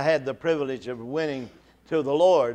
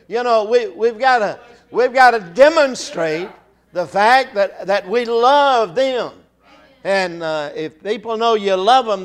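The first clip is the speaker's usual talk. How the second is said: louder, raised preaching voice pressing the point; impassioned preaching; 3 semitones higher, 6 dB louder than usual. Acted. Preacher urging the congregation to love the lost, impassioned.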